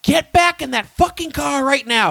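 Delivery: high, furious mock shout at full force; yelling cop. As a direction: bark it like an angry cop ordering you back. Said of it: "He's like".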